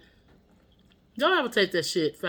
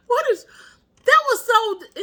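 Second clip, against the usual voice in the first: high, loud, helpless laughing speech; 8 dB louder than usual. Real, not acted. Vlogger on her own stupid mishap, really laughing. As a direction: crack up while trying to talk.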